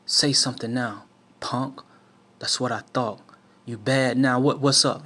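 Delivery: loud, threatening bark; menacing shout. Retold or imitated. Gunman threatening the boys from the car, loud menacing shout.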